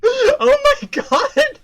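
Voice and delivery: high, laughing hard; real laughing fit. Real, not acted. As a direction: lose it laughing.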